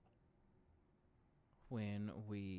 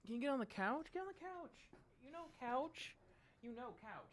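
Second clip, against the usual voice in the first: very high squeaky pet voice giving commands; 10 semitones higher, 9 dB quieter than usual. Real, not acted. Owner praising and directing her dog, squeaky pet voice.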